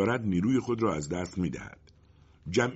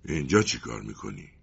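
lower, hostile question; rude tone; 9 semitones lower than usual. Acted. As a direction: demand it like a surly bully. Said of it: "با لحن بدی از او پرسید".

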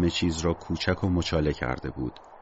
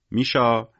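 mild call to a pet, a bit higher; raised voice, gently summoning; 4 semitones higher, 4 dB louder, slower than usual. A narrator's reading. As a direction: call the name out lightly. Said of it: "صدا زد".

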